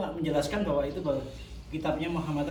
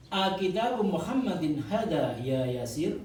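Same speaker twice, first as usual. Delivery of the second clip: slow, careful Arabic read for students to repeat; slow model reading; slower than usual. Shown as a demonstration. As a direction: read each word slowly so the class can follow. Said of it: "Saya baca jenengan anu ya". (bacaan contoh lambat untuk ditirukan murid)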